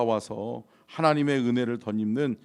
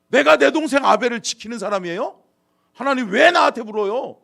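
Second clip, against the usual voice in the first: loud, high, indignant retort; defiant resentment; 9 semitones higher, 11 dB louder than usual. Acted. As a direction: deny it and throw the question back. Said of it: "이러면서 하나님 원망하잖아요".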